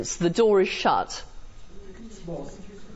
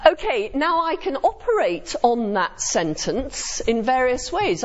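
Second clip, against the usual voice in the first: ordinary delivery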